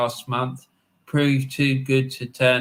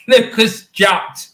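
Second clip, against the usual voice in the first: laughing, slow and loud; 8 semitones higher, 7 dB louder, slower than usual. Real, not acted. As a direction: savour the new name through a laugh. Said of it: "I'm just gonna call him Lucas Jutt".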